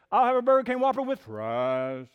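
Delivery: mock preacher ordering at a drive-thru; exaggerated preacher inflections. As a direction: pulpit voice with big swooping inflection. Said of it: "he changes inflections a lot".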